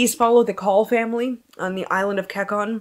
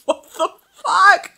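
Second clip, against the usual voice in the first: baffled husband; 12 semitones higher, 7 dB louder than usual. Retold or imitated. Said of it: "he's like".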